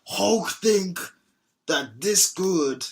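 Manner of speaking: put-on slow Hulk voice sliding into a Nigerian accent. Host doing the Hulk, drifting into a Nigerian accent. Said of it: "sliding into, like, a Nigerian accent".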